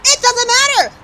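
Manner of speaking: high loud repeated yell; excited yelling. Real, not acted. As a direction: scream it again, just as high. Overtime winner goal.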